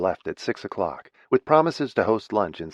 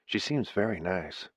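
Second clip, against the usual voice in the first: a quiet, hushed remark; gentle murmured aside; 8 dB quieter than usual. A narrator's reading. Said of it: "he said quietly".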